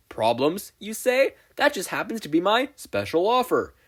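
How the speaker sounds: high, loud huckster pitch; glitchy pitchman announcing himself; Spamton salesman voice. Acted.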